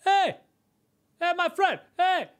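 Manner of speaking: high, loud hailing shout; imitated stranger's insistent holler; yelling across street. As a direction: call out to someone across the street. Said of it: "yelling from across the street".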